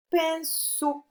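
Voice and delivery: syllable by syllable. Shown as a demonstration. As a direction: say pinceau in two distinct syllables. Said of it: "on entend ce sur la seconde".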